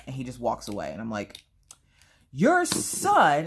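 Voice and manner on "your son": high, loud theatrical accusation; mock outrage. Wife complaining to husband about son, mock outrage. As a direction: accuse with melodramatic indignation.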